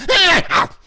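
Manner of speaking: very high, loud cackling shriek; shrieking laugh. Retold or imitated. Debbie reacting to the golfers, shrieking laugh. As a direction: burst into a wild shriek. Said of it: "And Debbie went".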